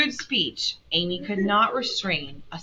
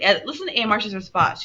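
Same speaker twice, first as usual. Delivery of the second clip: ordinary delivery, nothing marked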